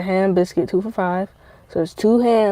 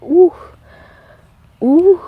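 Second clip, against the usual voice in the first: high, louder "ooh", done twice; delighted "ooh"; 9 semitones higher, 4 dB louder than usual. Retold or imitated. Speaker showing how good re-crisped pizza is, delighted oohs. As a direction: let out a high mock-swooning "ooh".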